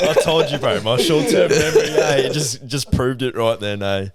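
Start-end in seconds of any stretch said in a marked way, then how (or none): 0.1-2.2 laughing and louder. Real, not acted. Friend laughing at his own bad memory, laughing. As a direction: laugh it out at yourself.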